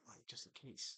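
unvoiced hush, just in case; whispering on the phone. Real, not acted. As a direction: whisper it so nobody overhears. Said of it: "why are you whispering?".

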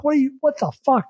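sudden high, fast what the fuck; startled outburst. Retold or imitated. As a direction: blurt it out in shock. Startled by the bed alarm.

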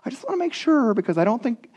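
high-pitched, hammed-up fussy governor voice; Tatanai. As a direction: put on a squeaky doubting official. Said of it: "I don't know if that's how he talked".

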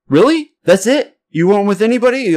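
high, surprised stranger's voice; taken aback. Retold or imitated. Acts out stranger's surprised reply.

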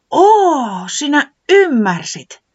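high, loud and slow; Tiputi delighted. Acted. Tiputi delighted that Pöllis understood, high and slow. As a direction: stretch out the joy.

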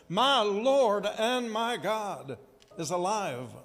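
high, slow, enthusiastic proclamation; exuberant, excited. Shown as a demonstration. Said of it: "with great exuberance and excitement and enthusiasm".

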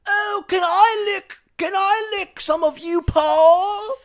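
very high, crying British voice; whiny Liverpool accent; Beatle impression. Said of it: "This is what all the [name] sound like".

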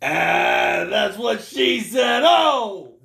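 slow, hammed-up New York punchline; crowing New Yorker act; New York accent. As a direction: draw it out like a New York wiseguy. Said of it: "talking with a New York accent".

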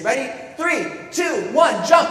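put-on high, loud kids' countdown; excited countdown. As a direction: build to a shouted launch. Siblings' countdown to a jump.

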